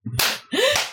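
short squealing laugh; high laugh. Real, not acted. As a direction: burst out laughing. Laughing at the answer.

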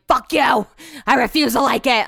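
loud, exasperated burst; mock-angry shout; mock outrage. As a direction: spit it out like a petty, fed-up outburst. Acts out angry reaction to friend.

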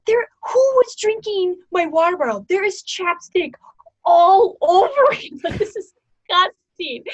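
high, loud, grossed-out whine with a laugh breaking through; disgusted complaint. Retold or imitated. Teammate complaining to the team about her straw, in a high grossed-out whine.

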